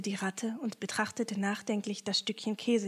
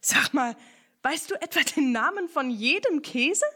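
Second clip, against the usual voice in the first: louder, higher excited asking; eager question; 5 semitones higher, 6 dB louder than usual. Acted. Ernie eagerly asks Picondu about cheeses, loud and excited. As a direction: burst out with curiosity.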